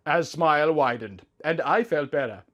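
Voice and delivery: ordinary delivery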